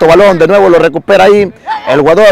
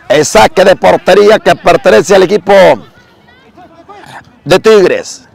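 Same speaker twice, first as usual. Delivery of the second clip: ordinary delivery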